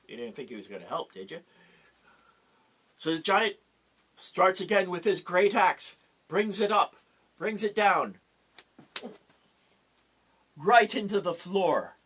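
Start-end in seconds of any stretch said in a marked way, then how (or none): 3.0-12.0 dramatic build. A narrator's reading. Narrating the axe coming down.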